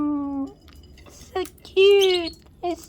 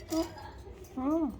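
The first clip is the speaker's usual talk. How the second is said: ordinary talk, nothing marked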